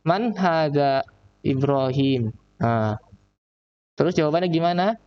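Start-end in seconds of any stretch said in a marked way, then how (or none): 0.0-2.3 very slow, drawn-out question; slow model reading. Shown as a demonstration. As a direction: read slowly for pupils to copy. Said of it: "Nah, Ibrahim ini".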